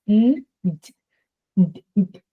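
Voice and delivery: "N, d" sounded out bare, the "d" repeated; short separate "d" taps after an "N"; isolated sounds. Shown as a demonstration. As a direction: sound out "N" against "d", one by one. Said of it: "Those are two different sounds".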